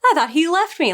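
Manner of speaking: high, eager delight at seeing a friend; hammed-up happy surprise; excited relief. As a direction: pitch up, thrilled and relieved. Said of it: "he must have been like".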